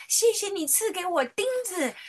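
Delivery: put-on 谢谢 sung out high in a childlike thank-you, 谢 stressed with a falling tune; a little girl thanking Jesus for nails; child praying. Little daughter thanking Jesus in Sunday school, high childlike voice. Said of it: "他就祷告说".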